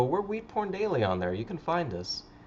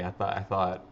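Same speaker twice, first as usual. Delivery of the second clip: ordinary talk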